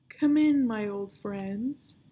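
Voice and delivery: loud, slow, grand welcome from the villain; villain's greeting. A narrator's reading. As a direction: boom it out like a host with a secret. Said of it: "comes a voice I know too well".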